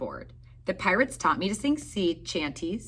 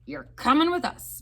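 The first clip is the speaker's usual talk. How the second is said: pirate voice; 8 semitones higher than usual. A narrator's reading. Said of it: "Braid Beard told me".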